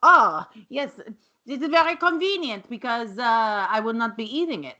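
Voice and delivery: lightly voiced haughty French chef; a hint of a dismissive Frenchman; mild mock-French disdain; snooty Chef Antoine. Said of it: "he's like".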